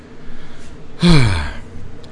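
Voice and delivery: long breathy sigh of frustration; exasperated sigh. Real, not acted. Podcaster fed up with timid poets, exasperated sigh. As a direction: let out a long, fed-up exhale. Exasperated after "Jesus fucking Christ.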